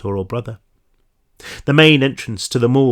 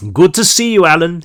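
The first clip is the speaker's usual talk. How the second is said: character voice; 6 semitones higher, 5 dB louder than usual. Acted. Pathologist greets through mask.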